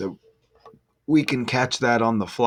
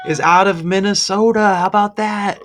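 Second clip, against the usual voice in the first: higher and louder triumphant payoff; excited; 9 semitones higher, 6 dB louder than usual. Real, not acted. Vlogger crowning the Minnesota match for viewers, triumphant and loud. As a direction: land the punchline like a big reveal. Climax of number reveal.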